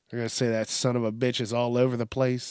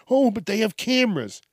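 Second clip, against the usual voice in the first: higher-pitched mocking objection; mock objector; 8 semitones higher than usual. Objector countering that there are cameras, high mocking voice. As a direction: parrot the excuse in a high voice.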